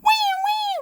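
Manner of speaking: high, loud toy wail; pretend crying. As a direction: squeal a make-believe little cry. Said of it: "Lion go".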